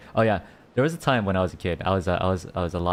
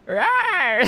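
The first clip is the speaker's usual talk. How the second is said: very high, louder little squeaky roar; playful tiger roar; 6 dB louder than usual. Pretend. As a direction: roar like a tiny tiger, breaking into laughter. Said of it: "I sound like a puppy?".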